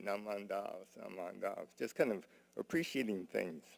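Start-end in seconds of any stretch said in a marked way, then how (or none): 0.0-1.8 soft and low Naman Dabs, Naman Dabs; said quietly. A narrator's reading. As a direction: murmur it under the family chatter. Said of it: "Ji-chan would just say quietly".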